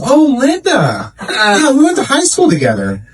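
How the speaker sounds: delighted small-town gossip; chatty recognition. Retold or imitated. Therapist recognises the acupuncturist's name.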